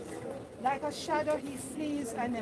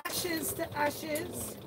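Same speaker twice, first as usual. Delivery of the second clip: slow, hushed committal words; hushed graveside prayer; slower than usual. Acted. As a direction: let each phrase fall slowly at the grave.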